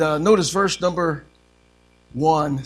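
ordinary talk

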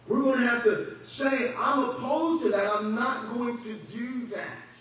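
slow, loud, high, hitting not; I'm not going to do that, slow, with the weight on not; firm refusal. Shown as a demonstration. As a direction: plant your feet and refuse. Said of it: "preaching a firm refusal".

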